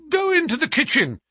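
high, loud, carrying instruction. A narrator's reading. Trevor sending the sailor to the kitchen, out loud. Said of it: "he continued out loud".

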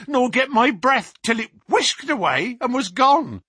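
the policeman reliving the face at the window; terrified constable. Acted. Constable Walters telling Baines and Holmes of the beast, high and terrified.

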